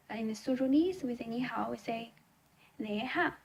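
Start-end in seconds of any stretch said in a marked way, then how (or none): none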